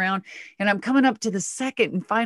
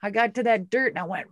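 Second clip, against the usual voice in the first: ordinary talk, nothing marked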